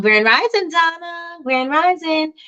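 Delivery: bright, lifted call to a viewer; cheery greeting. Real, not acted. Greeting a viewer in chat, lifted and cheery.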